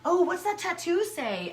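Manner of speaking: high, eager mock question; curious stranger. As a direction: play the nosy admirer asking. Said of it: "people would be like".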